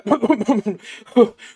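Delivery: high excited laugh. Acted. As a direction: laugh with relief. Sheep laughing with relief.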